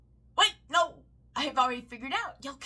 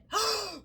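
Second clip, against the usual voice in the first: a sharp, hammed-up gasp of shock; mock gasp; 9 semitones higher than usual. Ranting about conservatives, a mocking shocked gasp. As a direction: gasp as if scandalised.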